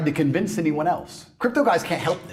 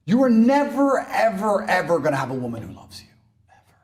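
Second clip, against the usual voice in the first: ordinary delivery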